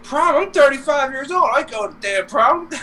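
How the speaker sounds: high-pitched cartoon incredulity; retold disbelief. Retelling the reply to a prom invitation, high mock disbelief. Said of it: "He's like".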